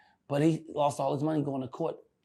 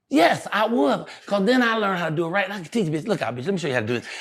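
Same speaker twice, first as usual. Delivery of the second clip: higher, louder cocky answer; [name]'s boast; 5 semitones higher, 7 dB louder, faster than usual. Retold or imitated. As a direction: brag like you're teaching a fool. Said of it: "And I said".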